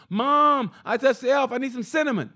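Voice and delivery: high, lightly voiced fretting child; worried kid. Kid confessing to his mom he touched the elf, worried. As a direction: plead to mom like a guilty little boy.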